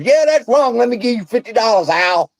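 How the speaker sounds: mocking gullible followers. Mocking viewers who pay Al, high eager imitation. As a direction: play the gullible fan handing over money. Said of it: "And everybody goes".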